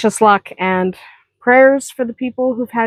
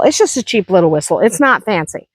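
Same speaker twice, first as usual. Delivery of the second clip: normal talking voice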